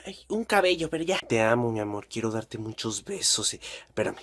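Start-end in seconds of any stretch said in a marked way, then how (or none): 1.3-4.2 low, soft cooing voice; lovey phone talk. Acted. Teen on the phone murmuring to a lover, soft.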